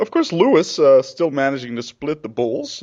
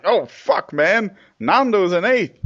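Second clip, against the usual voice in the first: higher, hammed-up dismay; mock dejected team; 8 semitones higher, 3 dB louder, slower than usual. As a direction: moan it as the let-down team. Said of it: "the whole team was dejected".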